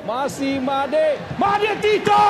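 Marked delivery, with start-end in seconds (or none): 1.4-2.2 rising excited yell; excited shout. Real, not acted. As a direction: shout the name as he shoots. Striker about to score.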